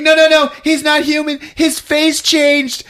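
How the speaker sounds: high, loud frantic warning; hammed-up victim, stretching the last words; mock panic. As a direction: squeal it in alarm.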